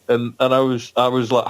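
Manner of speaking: usual speaking voice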